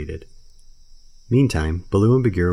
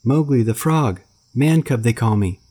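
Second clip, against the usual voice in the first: put-on calling boy; 6 semitones higher, 5 dB louder than usual. A narrator's reading. Mowgli calling while carried off.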